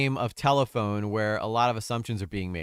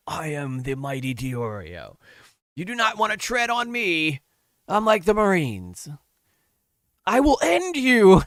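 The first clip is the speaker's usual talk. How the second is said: mock almighty Diorio; 6 semitones higher, 4 dB louder than usual. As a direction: ham up a self-important bully threatening someone. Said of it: "he's the almighty Diorio".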